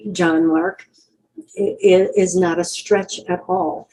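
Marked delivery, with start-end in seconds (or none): none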